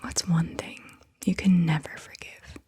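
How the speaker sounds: ordinary talk, nothing marked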